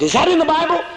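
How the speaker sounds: surprised questioner. Retold or imitated. Imagined woman asks.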